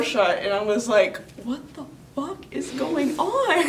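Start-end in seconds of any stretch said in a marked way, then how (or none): 1.4-3.7 laughing in disbelief, high; shocked laughter. Real, not acted. Shocked after ghost leaves.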